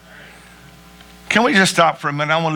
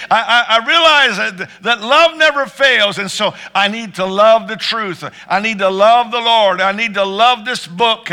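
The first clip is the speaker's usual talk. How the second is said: building preaching; 6 semitones higher than usual. Real, not acted.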